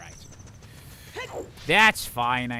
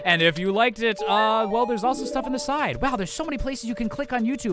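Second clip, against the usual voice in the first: YouTuber outro voice; 8 semitones higher than usual. Shown as a demonstration. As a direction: gush like a YouTuber begging for clicks. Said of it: "I could still immediately go into the improving, uh, outro, like, end slate voice".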